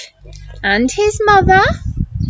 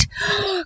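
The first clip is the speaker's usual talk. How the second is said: gasp; 3 semitones higher, 10 dB quieter than usual. Acted. Reader gasps at story.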